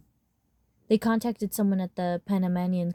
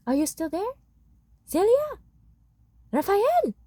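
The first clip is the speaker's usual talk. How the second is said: much higher and louder, calling down a line; yelling through the phone; 13 semitones higher, 4 dB louder than usual. Acted. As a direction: shout the names up high to be heard over the phone. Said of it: "My aunt started yelling through the phone".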